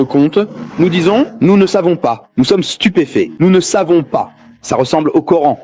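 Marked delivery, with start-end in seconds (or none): none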